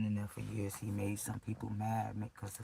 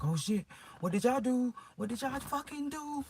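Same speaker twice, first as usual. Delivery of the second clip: distressed demand; 3 dB louder than usual. Retold or imitated. Waking sore and confronting the captors, distressed demand. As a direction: relive it as a panicked, wailing question.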